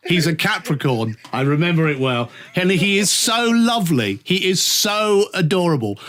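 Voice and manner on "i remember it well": ordinary delivery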